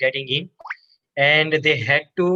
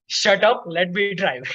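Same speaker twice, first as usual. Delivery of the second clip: higher-pitched imitation of an irritated driver; mock aggressive retort; 4 semitones higher, slower than usual. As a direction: snap it back in playful mock aggression. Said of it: "aggressively says".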